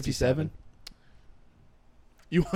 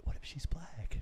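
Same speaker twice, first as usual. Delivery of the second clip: hushed whisper; 3 semitones lower, 16 dB quieter, slower than usual. Real, not acted. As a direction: whisper it so she can't hear. Whispering about girl in room.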